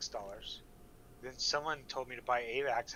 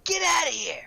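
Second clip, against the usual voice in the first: high, loud mock Boston heckle; angry local; 11 dB louder than usual. Red Sox fan curses the man in the Yankees hat, loud and high. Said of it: "He's like".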